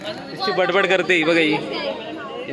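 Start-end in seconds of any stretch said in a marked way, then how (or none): 1.5-2.5 slow whisper; whispered aside. Real, not acted. Vlogger whispering to viewers about the chatty bridesmaid. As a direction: whisper it slowly so she won't hear.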